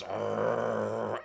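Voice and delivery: imitated warning growl, held steady; restrained, drawn-out dog growl; dog's growl. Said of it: "as you put him down, he goes".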